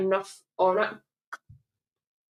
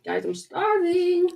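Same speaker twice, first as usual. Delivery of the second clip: whiny; 9 semitones higher, slower than usual. Real, not acted. Repeats starving, drawn out.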